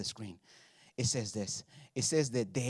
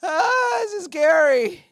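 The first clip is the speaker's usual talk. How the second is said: high, loud, whimpering mock fear; hammed-up shaking in boots; mock terrified leaders; 9 dB louder, slower than usual. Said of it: "shaking in their boots".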